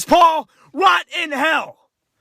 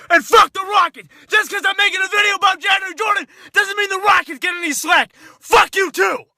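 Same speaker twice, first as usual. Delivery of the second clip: furious yell; 7 semitones higher than usual. Real, not acted. Angry fan rants at Rockets.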